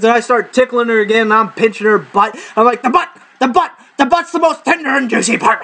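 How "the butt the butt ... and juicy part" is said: high gleeful creature voice; greedy ice weasel. Pretend. Ice weasel voice going after her butt. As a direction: relish it like a hungry critter.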